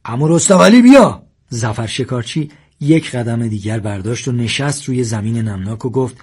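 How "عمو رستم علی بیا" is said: high, loud yell to an uncle; shouted call. A narrator's reading. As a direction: call out urgently from cover. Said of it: "مدد هم داد زد". (صدا زدن با فریاد بلند)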